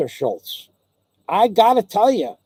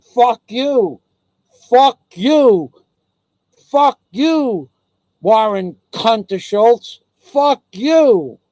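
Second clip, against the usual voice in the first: higher, louder repeated fuck you, hammed-up fury; angry retold yell; 4 semitones higher, 3 dB louder, much slower than usual. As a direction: spit it at Warren Kunterschultz again and again. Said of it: "I said".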